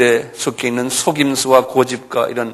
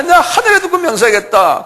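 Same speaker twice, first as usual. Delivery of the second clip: very high-pitched protesting oath; vehement oath; 3 dB louder than usual. Acted. Peter swears by heaven to his accusers, high protest.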